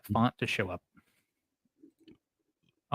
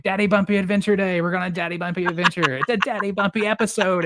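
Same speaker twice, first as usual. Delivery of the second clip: high-pitched hype-man dad; super animated; 6 semitones higher than usual. Retold or imitated. Dad hyping up his son for an adventure, super animated. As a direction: pitch it like a thrilling kids' show. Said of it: "super animated".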